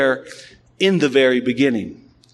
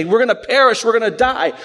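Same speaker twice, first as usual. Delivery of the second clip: mock panic of sailors afraid to drown; frightened disciples shouting we're going to die; panicked fishermen; 6 semitones higher, faster than usual. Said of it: "They're freaking out".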